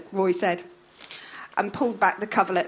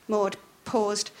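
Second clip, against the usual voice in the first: plain reading voice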